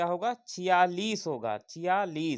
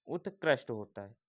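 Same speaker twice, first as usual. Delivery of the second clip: usual speaking voice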